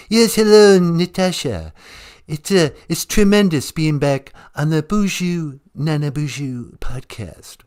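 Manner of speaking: a half-hearted Trump bragging to the host; Donald Trump impression. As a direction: caller hamming up a mild Donald Trump imitation. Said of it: "here's my Donald Trump impersonation".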